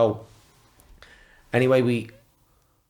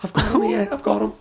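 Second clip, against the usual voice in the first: much higher, squeaky triumph; gleeful inner voice; 12 semitones higher than usual. Retold or imitated. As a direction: gloat like you've won. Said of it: "I'm like".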